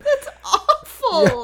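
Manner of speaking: high, loud, drawn-out words through laughter; laughing dismay. Real, not acted. Co-host reacting to the crude line, helpless laughter. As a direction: wail it slowly while cracking up. Cracking up at the quirk.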